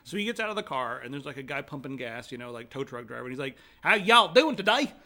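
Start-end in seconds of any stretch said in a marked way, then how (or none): 3.8-4.9 loud, slow, overdone Southern drawl; fake Southern accent. New Yorker greeting tow truck driver, fake Southern drawl. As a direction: badly fake a booming high Southern greeting. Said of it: "put on my Southern accent".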